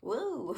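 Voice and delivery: high-pitched little "woo"; imitated knocked-back "woo". Reader imitating a bird knocked back by anger, high playful woo. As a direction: voice a bird being blown backwards, woo. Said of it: "this other bird is, like".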